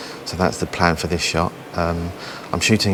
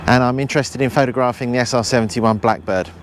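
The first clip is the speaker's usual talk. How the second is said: raised, higher voice outdoors; shouting over wind; 7 semitones higher than usual. Real, not acted. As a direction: project over the wind noise. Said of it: "I hope you can hear me over the wind noise".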